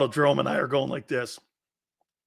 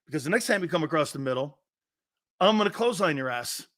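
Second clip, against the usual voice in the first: usual speaking voice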